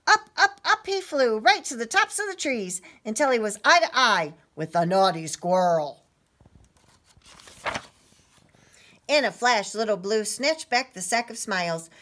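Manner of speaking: high, rising storybook excitement; loud, lively opening words; excited story reading. A narrator's reading. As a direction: sweep children along with the action. Reads story to children.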